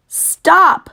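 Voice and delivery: high, loud piglet scream. Acted. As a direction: scream to make her stop. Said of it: "screamed Wilbur".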